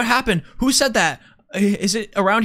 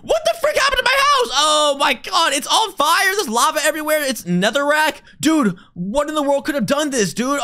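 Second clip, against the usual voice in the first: high, outraged disbelief; play-acted panic over a burning house; distraught shouting; 7 semitones higher, 3 dB louder than usual. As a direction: yell in pitched-up horror at the wreck. Reacting to burning, wrecked house.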